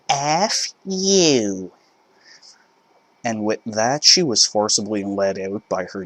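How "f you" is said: angry mother. Acted. Mother storming out.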